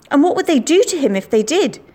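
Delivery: ordinary delivery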